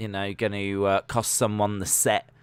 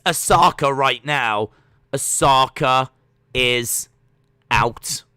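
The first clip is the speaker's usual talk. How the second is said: excited commentary; 4 semitones higher, 6 dB louder than usual. Real, not acted. Emphatic tense commentary, louder.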